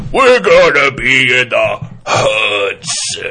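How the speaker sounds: slow, loud wrestling promo voice; WWF wrestler. Retold or imitated. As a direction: growl it like a pro wrestler. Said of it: "like a W W F wrestler".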